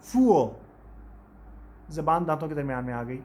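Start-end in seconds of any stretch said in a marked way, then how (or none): 0.0-0.5 फूल said louder, with a clear final l; pronunciation demo. Shown as a demonstration. Teacher showing students how फूल ends. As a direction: stress the l at the end of फूल. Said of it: "तो किस तरह से pronounce किया जाता है?".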